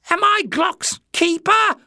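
very high, loud screech; slow, piercingly high retort. A narrator's reading. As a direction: screech it back indignantly, drawn out. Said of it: "screeched Jinx".

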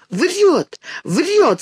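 high furious cry; sudden fury. A narrator's reading. Girl jumps up accusing the boy, sudden high fury. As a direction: shriek the accusation with sudden rage. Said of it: "с неожиданной яростью вскакивает Динка".

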